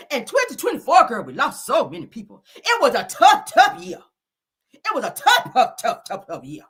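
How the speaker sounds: high, insistent repetition; mock-passionate recital; dramatic poem reading. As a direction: hammer the word tough.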